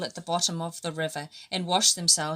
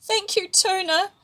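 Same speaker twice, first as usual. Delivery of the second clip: grateful; 12 semitones higher, 3 dB louder than usual. A narrator's reading. Grateful fish thanks Tuna.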